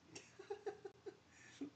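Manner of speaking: breathy high-pitched laugh; high giggle. Real, not acted. Streamer giggling at his own blunder. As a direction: burst into a squeaky giggle.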